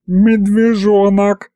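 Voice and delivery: high, slow howl with squeals of laughter; howled through laughter. A narrator's reading. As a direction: stretch it out howling and giggling. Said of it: "провыл Аристофан, ... повизгивать от смеха". (протяжно воет сквозь смех)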